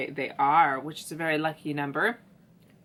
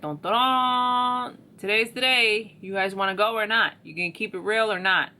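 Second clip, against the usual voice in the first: mock archangel call; 4 semitones higher, 5 dB louder than usual. Archangel Gabriel calling the dead out of coffins, hammed-up. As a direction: herald the resurrection with mock drama.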